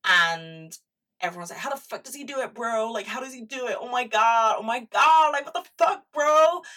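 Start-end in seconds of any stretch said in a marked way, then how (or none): 1.6-6.6 high, loud mocking bro voice building to a shriek; mock bro disbelief. Vlogger imitating baffled guys asking how Pete does it, mock bro shriek. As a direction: ham up an amazed bro, higher and louder each line.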